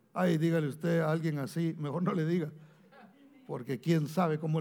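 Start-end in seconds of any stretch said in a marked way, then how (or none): none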